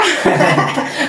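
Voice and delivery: short breathy laugh at the joke; laughing at a funny remark; real laugh. Real, not acted. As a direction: let a real laugh escape.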